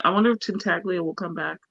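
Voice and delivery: usual speaking voice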